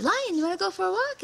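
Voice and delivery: high, quick, sugary invitation; sweet trickster invite. Acted. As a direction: lure him in with fake friendliness. Spider lures the lion sweetly.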